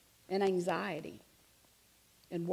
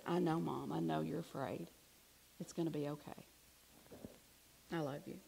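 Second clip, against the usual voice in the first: soft, gentle comfort for a frightened mother; tender reassurance; 3 semitones lower, 6 dB quieter than usual. Retold or imitated. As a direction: hush her fears softly. Hugging her crying mother.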